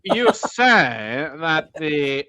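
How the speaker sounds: hammed-up strange old Southern gentleman, very slow; William F Buckley. Podcaster mimics an archaic American debater, expansive drawl. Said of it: "William F Buckley kind of accent".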